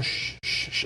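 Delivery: soft breathy shh, no voice; a soldier hushing his dying victim, whispered; whispered shushing. Retold or imitated. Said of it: "the Nazis going".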